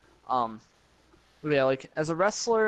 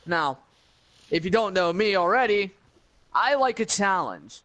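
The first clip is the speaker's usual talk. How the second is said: higher, louder, heated challenge; wrestling promo; 5 semitones higher, 4 dB louder than usual. Acted. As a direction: call him out, building intensity. Call-out promo to rival.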